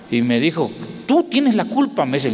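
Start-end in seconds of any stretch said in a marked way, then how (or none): 1.1-2.0 high, blaming scold; scolding Klaus. Retold or imitated. Klaus blaming the preacher, high scolding voice. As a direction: point the finger at him. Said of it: "Y me regañó Klaus".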